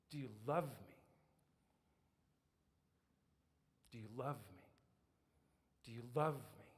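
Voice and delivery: low, soft, searching voice; hushed, deep and weighty; tender questioning. Acted. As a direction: ask it gently and intimately. Jesus gently questions Peter three times.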